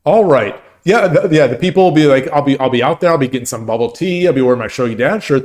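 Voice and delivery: ordinary talk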